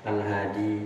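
Al-Hadi with the final di left unpressed; unstressed final di. Shown as a demonstration. Teacher showing students an ending without tasydid, unpressed final di. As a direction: say Al-Hadi softly, no doubled consonant on di. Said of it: "Jadi nggak ditekan".

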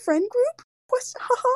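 put-on high, cutesy baby voice. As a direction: coo it like a toddler. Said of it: "Like, a baby voice".

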